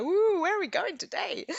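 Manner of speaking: high, swooping playful whoop; playfully mock your own loopy wandering mind; loopy and weird. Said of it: "a bit loopy and weird".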